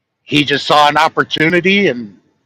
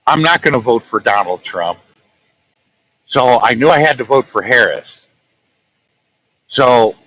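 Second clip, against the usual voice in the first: normal talking voice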